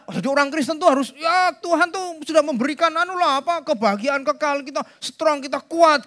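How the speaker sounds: mock upbeat believer.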